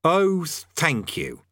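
slow, bitter thanks. A narrator's reading. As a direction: drag it out sourly. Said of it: "said Rincewind bitterly".

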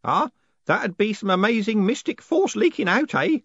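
put-on high, hopeful old man grasping; cling to a last hope; desperate. Groat clutches at a mystic explanation, desperate and high. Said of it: "said Groat desperately".

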